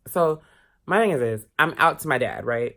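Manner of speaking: usual speaking voice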